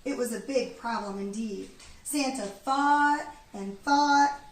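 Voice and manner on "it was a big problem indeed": usual speaking voice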